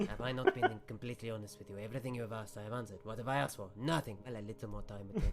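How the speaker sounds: lightly voiced wizard pleading his honesty; mildly put-upon wizard, starting with a laugh; protesting wizard. Game master voices the wizard.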